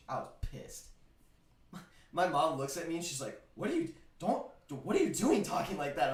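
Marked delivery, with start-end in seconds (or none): 3.6-6.1 1 imitating scolding mom.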